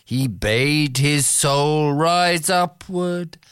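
recited poetry, unhurried and musical; poem recitation. Acted. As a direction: read it like a bard with the Irish refrain. Begins another Yeats poem.

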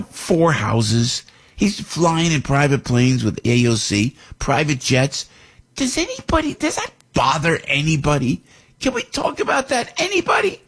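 high, put-on Brooklyn Bernie Sanders voice; mock Bernie in a squeaky Brooklyn accent; hammed-up Brooklyn Bernie; Bernie impression. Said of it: "with the Bernie, uh, accent".

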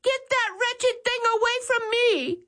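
very high, desperate pleading voice; woman on a bench begging them to remove the rat; pleading scream. A narrator's reading. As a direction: beg in a high frantic voice. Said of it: "standing on the piano bench screaming".

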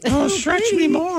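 high, drawn-out put-on voice; affected voice. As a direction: stretch it out in a silly high voice. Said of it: "Did you affect a voice?".

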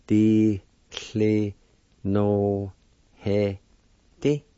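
Di, cle, no, he, di said apart; syllable by syllable. Shown as a demonstration. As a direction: space out the syllables. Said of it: "It's pronounced like this".

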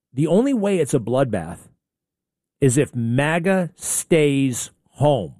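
normal talking voice

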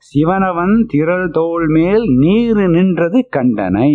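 quick chanted Tamil verse line; verse recital. Acted. Teacher quoting the saint's verse to his class, chanting. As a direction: rattle off the hymn line in singsong.

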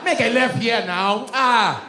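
put-on high, impatient Nigerian accent; Nigerian GPS. Nigerian GPS losing patience, high Nigerian accent. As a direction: order it sharply in a Nigerian accent. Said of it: "a Nigerian on a G P S".

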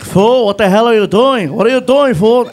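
loud angry yell. Retold or imitated. Friend in back seat yelling at the driver, loud. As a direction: bark it at the driver. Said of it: "is yelling at me".

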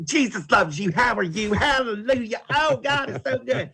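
very high, sing-song mock piety; fake churchgoer gushing. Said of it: "they're fake, they're false".

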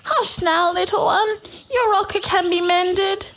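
high, soothing mama koala coo. A narrator's reading. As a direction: coo slowly to soothe a crying baby. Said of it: "she cooed".

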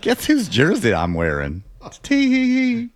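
mocking sly imitation ending in a high titter; coy taunt, then squeaky tee-hee; impish cousin. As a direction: play an impish Southern tease. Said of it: "I have an impish grin".

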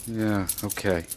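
barely awake, sleepy answers; half asleep. Acted. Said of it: "You sound like you're half asleep".

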